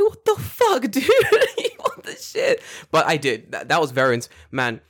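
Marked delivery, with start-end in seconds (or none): 0.0-2.6 very high, squeaky disbelief; comic falsetto-high exasperation; incredulous outrage. Retold or imitated. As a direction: laugh through a squealed protest.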